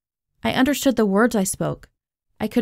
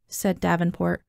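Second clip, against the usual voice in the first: ordinary delivery, nothing marked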